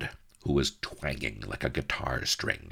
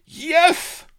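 loud, very high villain yell; shouting; 10 dB louder than usual. A narrator's reading. Don Lardo answers his bound captive, shouting. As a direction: shout it at full force. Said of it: "shouted Don Lardo".

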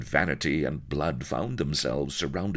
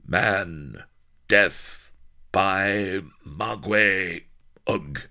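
clipped caveman pidgin, slightly higher and louder; jungle pidgin talk; 7 semitones higher, 7 dB louder, much slower than usual. Acted. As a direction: grunt it out like a jungle native. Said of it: "She knew how to talk like a jungle denizen".

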